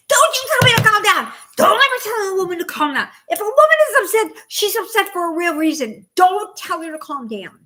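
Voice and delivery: high, loud, fast yelling; fed-up outburst. Retold or imitated. Wife yelling at husband who said calm down, fed up. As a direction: go off on him at full volume. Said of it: "I just was, like, over it".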